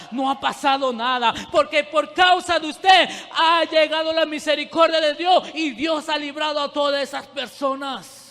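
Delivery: fervent preacher building up; building preaching. Real, not acted. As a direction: drive the point home, building. Preaching builds to full force.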